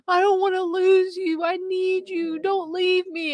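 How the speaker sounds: high, wailing imitation of crying; mock tearful plea. Crying husband begs his wife to stay, imitated high wail. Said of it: "and he started crying".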